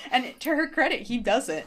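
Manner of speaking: usual speaking voice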